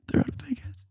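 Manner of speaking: whispered aside. Real, not acted. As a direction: hush it like a secret. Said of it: "whispered aside".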